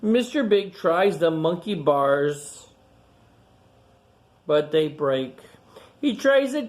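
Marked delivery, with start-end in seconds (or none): none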